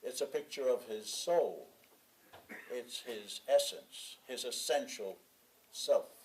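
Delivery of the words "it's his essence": ordinary delivery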